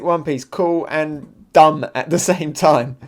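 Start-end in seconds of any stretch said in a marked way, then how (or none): none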